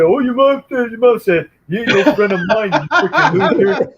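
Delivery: high nasal jeer; mocking taunt. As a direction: sneer at the mama's boy. Bully mocking mama's boy.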